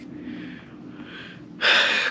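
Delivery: long, heavy acted sigh; exasperated sigh. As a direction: let out a drawn-out breath of disapproval. Coworker reacts to the banana.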